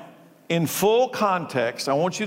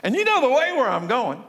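excited; 6 semitones higher, faster than usual. Pretend. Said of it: "I picture Jesus raising his voice like he's excited".

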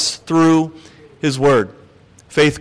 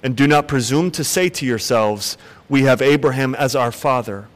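measured, solemn Bible reading; scripture reading; 3 semitones lower than usual. Acted. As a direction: read the Gospel aloud with weight.

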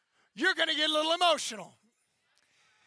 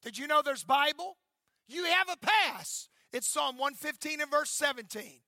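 ordinary talk, nothing marked

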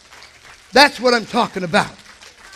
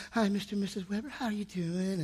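mock sweet greeting; 2 semitones lower, 15 dB quieter than usual. Imitating sweet doctors.

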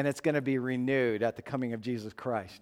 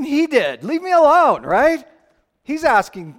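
exasperated; 11 semitones higher, 10 dB louder than usual. Retold or imitated. Indignant retelling.

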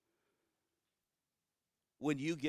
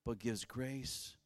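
slow, solemn scripture quote; scripture reading; slower than usual. Acted. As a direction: lay each word down slowly. Quoting scripture.

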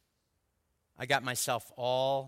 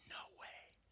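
mock amazed whisper; 20 dB quieter than usual. Mock amazement at her birthday.

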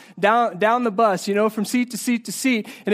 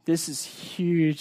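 usual speaking voice

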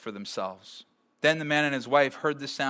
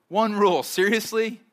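incredulous; 7 semitones higher, 5 dB louder than usual. Real, not acted. Pastor marvels at only one rule.

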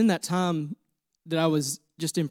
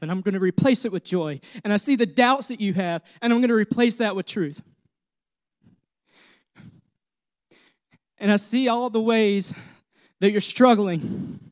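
God's reassuring words, rising higher and louder; voicing God, building; 4 semitones higher, 4 dB louder than usual. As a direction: start tender, let it swell to a strong promise. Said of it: "God said".

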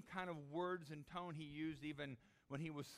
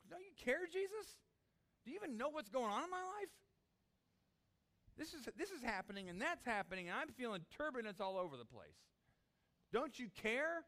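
high, loud desperate cry; a believer in turbulence crying to Jesus, high and loud; 10 semitones higher, 6 dB louder than usual. Acted. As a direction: call out to Jesus in turmoil.

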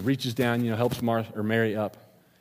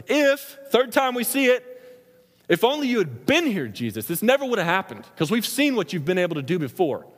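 louder, higher preaching surge; rising fervor; 9 semitones higher, 4 dB louder than usual. Real, not acted. Preacher to congregation, heated rising voice. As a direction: let the anger at Satan build. Quoting the grieving crowd loudly.

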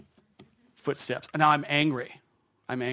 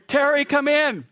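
high, loud, slow call from the door; wife calling; 13 semitones higher, 9 dB louder than usual. Retold or imitated. Wife at the front door calling her husband inside, high and loud. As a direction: mimic wife calling him in. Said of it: "I hear Lisa at the front door saying".

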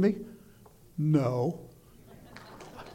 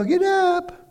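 much softer, half-hearted wake-up; soft get up; 7 semitones higher, 8 dB quieter than usual. Retold or imitated. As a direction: say it softly, as the way you don't do it. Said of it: "You just don't go".

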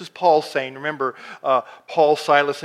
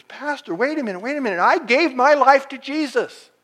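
high-pitched imitation of an objector; mock protest, rising in volume; protesting churchgoer; 9 semitones higher, 4 dB louder than usual. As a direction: act out someone interrupting the pastor to object. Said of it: "someone came up to me and says".

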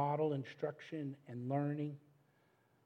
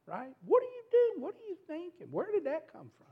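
much higher, louder, exasperated snapping; harsh scolding outburst; 8 dB louder than usual. Retold or imitated. Father snapping at his son while mowing yards, exasperated outburst. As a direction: blow up at him in disbelief. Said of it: "I said something like".

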